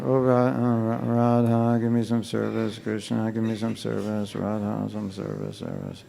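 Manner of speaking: mocking a careless devotee's chant; mock mindless prayer. Shown as a demonstration. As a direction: rattle it off like you don't mean it. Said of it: "I'm just being straightforward".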